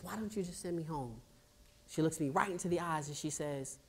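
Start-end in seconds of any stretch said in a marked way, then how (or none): 0.0-1.2 soft plea. Retold or imitated. Quiet repeat of plea.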